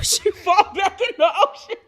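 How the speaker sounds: high and loud through laughter; can't get it out for laughing; cracking up at the fall. Real, not acted. Laughing while telling fall.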